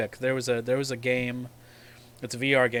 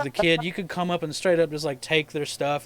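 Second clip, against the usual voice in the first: ordinary talk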